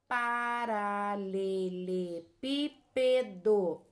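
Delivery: paralelepípedo slowly, every syllable held on a level note; slow, evenly spaced syllables in paralelepípedo. Shown as a demonstration. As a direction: count out pa-ra-le-le-pí-pe-do. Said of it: "Olha quantas sílabas eu tenho".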